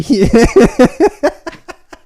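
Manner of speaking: loud high burst of laughter; laughing. Real, not acted. Laughing at own story.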